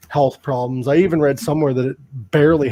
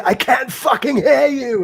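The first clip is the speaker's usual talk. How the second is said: higher mock shout at the crowd; rock star crowd shout; 10 semitones higher, 3 dB louder than usual. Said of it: "he'd say".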